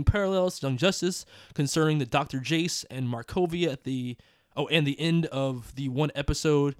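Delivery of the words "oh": ordinary talk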